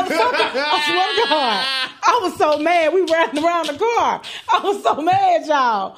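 laughing through the story; half laughing, half telling. Real, not acted. Laughing retelling.

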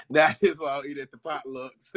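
laughing while repeating the line. Real, not acted. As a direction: say it through laughter.

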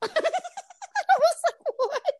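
high, drawn-out laughing what; stunned and cracking up; laughing shock. Retold or imitated. Friend reacts to the grinder claim, laughing shock.

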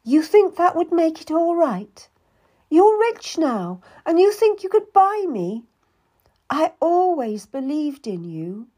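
high, loud wounded outburst; tearful anger. Acted. Cat accuses Anne of trying to buy her, tearful and angry. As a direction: pour out the betrayal.

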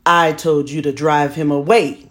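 loud stern father; father scolding his son, loud and stern; angry father. A narrator's reading. Angry father answers the boy.

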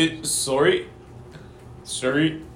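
put-on Canadian accent, a bit low-key; lightly done Canadian accent. Shown as a demonstration. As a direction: demonstrate a Canadian "eh, sorry". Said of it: "what you're supposed to sound like".

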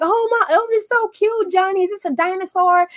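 put-on mother praising her child's drawing, gushing in a high voice; gushing mom. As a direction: gush over the child's drawing like a doting mother. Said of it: "you're like".

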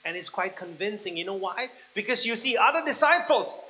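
loud preaching, building to a high shout; impassioned preaching. Acted. Preacher to believers tempted by the safety boat, shouting with passion. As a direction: build from forceful to full-throated, high-pitched exhortation.

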